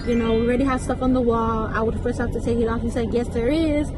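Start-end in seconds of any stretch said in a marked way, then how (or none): none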